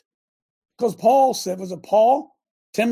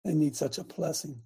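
hushed aside; 5 semitones lower, 10 dB quieter than usual. Retold or imitated. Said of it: "I said".

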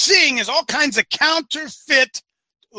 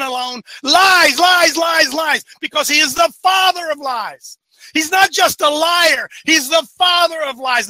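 fiery preaching; 5 semitones higher than usual. Acted. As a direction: hammer each point home from the pulpit. Preaching at full force.

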